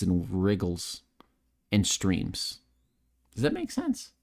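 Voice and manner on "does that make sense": sudden tiny falsetto squeak; squeaky high voice. Acted. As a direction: pop way up into a squeak.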